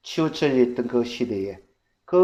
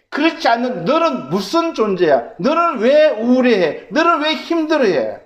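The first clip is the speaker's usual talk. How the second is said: loud, high, driving sermon build; fervent preaching; 9 semitones higher, 9 dB louder than usual. Acted. Pastor pressing the congregation, loud fervent preaching. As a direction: preach it to the rafters, rising.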